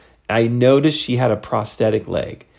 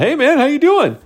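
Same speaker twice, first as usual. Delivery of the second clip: higher-pitched chummy hello; upbeat buddy impression, pitched up; cheery greeting; 11 semitones higher than usual. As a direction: imitate an eager stranger greeting you. Friendly stranger greets nervous first-timer.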